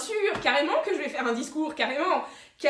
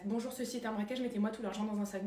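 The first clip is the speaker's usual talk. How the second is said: muttered practice under the breath, much softer; nervous rehearsal; 3 semitones lower, 9 dB quieter than usual. Acted. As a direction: rehearse the robbery line to yourself. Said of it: "C'est pas ça qu'il fallait que je dise".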